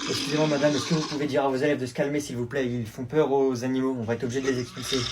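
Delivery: low, soft, restrained staff voice; polite complaint. Acted. Zoo employee asking teacher to calm pupils, low polite request. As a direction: keep it discreet and courteous.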